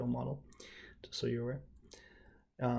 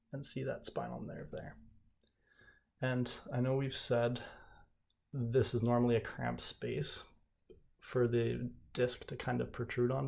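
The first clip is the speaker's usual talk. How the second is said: ordinary talk, nothing marked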